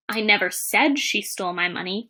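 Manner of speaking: "said" carries the main emphasis; stress on SAID. Shown as a demonstration. Teacher shows it was implied or written, stress on "said". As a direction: lean on "said", as if it was only implied. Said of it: "as different words are stressed".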